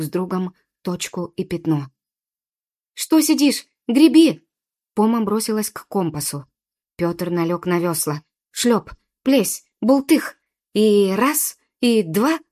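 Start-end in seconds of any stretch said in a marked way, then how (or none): 2.9-4.5 high, loud order to get moving; urgent scolding shout. A narrator's reading. Poma urging the boy to row, urgent scolding shout. 8.5-12.4 punchy, raised splash calls; loud beats in time with the oars; effortful rowing count. A narrator's reading. Boy pulling on the oars, effortful rowing count.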